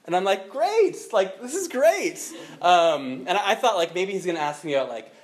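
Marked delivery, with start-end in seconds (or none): 0.5-2.2 high, eager gush of delight; mock over-the-moon enthusiasm; gushing like a thrilled fan; excited. Retold eager reply.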